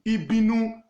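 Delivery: Ibinu with initial I and final -nu; Yoruba pronunciation. Shown as a demonstration. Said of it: "they now call it Ibinu".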